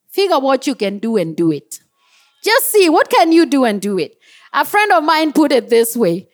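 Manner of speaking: usual speaking voice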